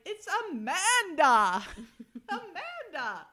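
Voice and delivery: laughing mock shout, high and loud; imitating a woman yelling her name into a payphone, laughing; yelling into phone. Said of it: "yelling into the phone to her mother".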